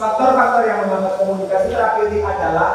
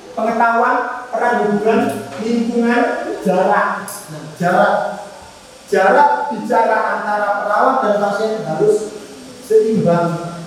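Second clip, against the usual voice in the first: ordinary delivery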